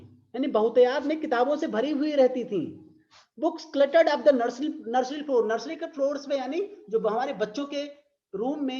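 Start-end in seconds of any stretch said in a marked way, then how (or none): 3.4-5.5 slow, sing-song poem reading; drawn-out recital voice; poem recitation. Shown as a demonstration. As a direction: read it out like a verse for class.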